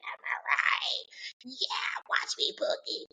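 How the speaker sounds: hushed, playful creature whisper; whispered alien reply. Acted. Little alien answers David, playful whisper.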